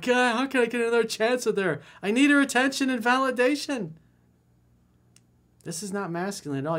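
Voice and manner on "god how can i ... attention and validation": high, pleading mock voice of a needy guy; mock needy whine. Dating coach mocking his needy client, high and whiny. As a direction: mimic a desperate pining guy, pitched up.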